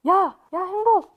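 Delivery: bully calling out. Retold or imitated. Rowdy boys bursting into class at their classmate, mock jeering call. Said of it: "반에 좀 노는 남자애들이".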